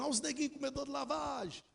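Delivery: high-pitched mocking taunt; high taunting call, not fully shouted; retold jeering shouts. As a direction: imitate kids jeering after someone. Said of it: "Eles gritando".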